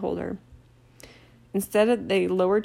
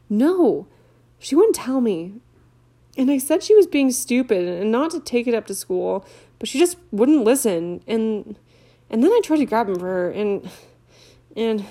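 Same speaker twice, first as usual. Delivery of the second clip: upset schoolgirl; 3 semitones higher, 5 dB louder than usual. Acted. Leanne to Harry, lightly voiced and upset. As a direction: tell it fretfully.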